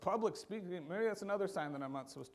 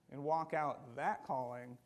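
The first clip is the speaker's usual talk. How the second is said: ordinary talk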